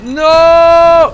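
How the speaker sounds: sudden loud anguished cry; shout. Real, not acted. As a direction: yell as the mine goes off. Stepping on a landmine.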